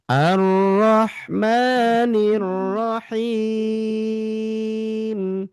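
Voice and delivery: slow chanted tartil; Quran recitation. Acted. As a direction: recite slowly, drawn-out vowels. (bacaan tartil pelan)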